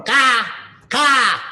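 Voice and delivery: high, loud cawing crow; loud crow call. Acted. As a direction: caw out like a crow. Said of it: "One was a crow".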